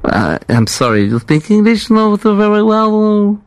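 slow, mock-puzzled broken English; prank caller. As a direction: drag it out as if puzzled by her English. Prank caller mocks receptionist's English.

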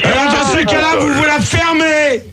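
angry shout. Real, not acted. Host tells callers to shut up, shouting angrily. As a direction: shout them all down.

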